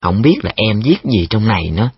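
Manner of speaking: plain reading voice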